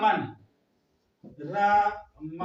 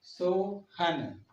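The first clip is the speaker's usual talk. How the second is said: Sohan stretched very slowly; slow drawn-out Sohan; much slower than usual. Shown as a demonstration. Teacher dictating a name, very slow. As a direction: draw out Sohan for the class. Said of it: "अगर हम फिर लिखें सोहन".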